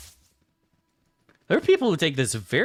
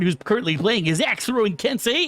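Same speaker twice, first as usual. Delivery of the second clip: esports caster voice; 6 semitones higher than usual. Pretend. Streamer mocks a League commentator for chat, hyped caster read. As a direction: sell it like an excited match announcer. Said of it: "Would you guys watch D to the Aveorn Deveorn?".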